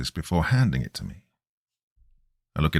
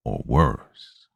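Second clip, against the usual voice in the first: menacing stranger; 9 semitones lower than usual. A narrator's reading. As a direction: hang the threat in the air. Stranger adds threat.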